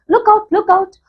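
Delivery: high-pitched cry at ordinary volume; mock shouted warning. A narrator's reading. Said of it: "voice seemed hoarse with shouting and it cried".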